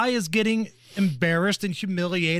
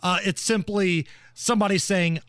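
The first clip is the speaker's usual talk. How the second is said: ordinary talk, nothing marked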